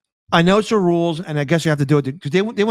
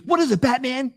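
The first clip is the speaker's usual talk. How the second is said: eager sidekick, pitched up; Robin's voice; 8 semitones higher than usual. Retold or imitated. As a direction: ask it like an eager boy sidekick. Robin voiced in retold episode.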